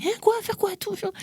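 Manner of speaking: high-pitched stunned disbelief; mother's high, shocked reply. Retold or imitated. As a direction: squeak it out like a startled mum. Mother reacts to the news.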